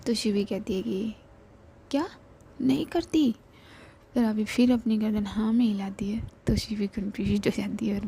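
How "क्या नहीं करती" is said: high, disbelieving question; can't believe it; puzzled friend. Acted. Shivi puzzled. (हैरानी से ऊँचा सवाल)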